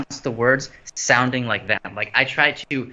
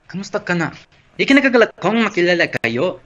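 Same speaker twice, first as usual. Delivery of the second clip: Filipino accent; 7 semitones higher than usual. Shown as a demonstration. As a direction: put on a thick Filipino accent. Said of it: "that's not the right accent for it".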